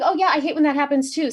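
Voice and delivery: brightly played-up agreeing; eager agreement. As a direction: chime in eagerly with the boss. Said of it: "I'm like".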